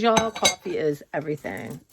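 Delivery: ordinary delivery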